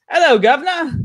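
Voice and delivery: hammed-up, high and loud British greeting; British accent. Shown as a demonstration. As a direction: call it out in a cartoonish British accent. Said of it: "say in a convincing British".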